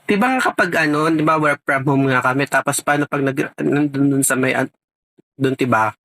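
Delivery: ordinary talk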